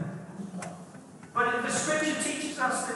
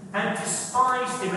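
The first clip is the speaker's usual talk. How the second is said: ordinary talk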